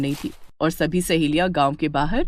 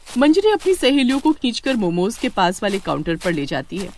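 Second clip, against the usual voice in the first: plain reading voice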